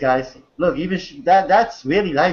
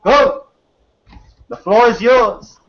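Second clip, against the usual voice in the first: hyped handoff; 6 semitones higher, 5 dB louder, slower than usual. Real, not acted. Host hands floor to guest.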